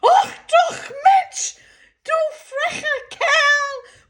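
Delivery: the mother shrieks at the big Dane in German; screaming in German. Retold or imitated. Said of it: "my mother screams out in German".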